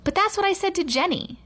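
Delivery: high-pitched pupil's protest. A narrator's reading. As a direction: object as if wrongly blamed. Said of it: "Terrence protested".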